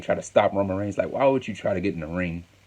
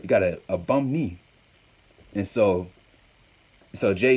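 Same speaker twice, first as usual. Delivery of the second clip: ordinary delivery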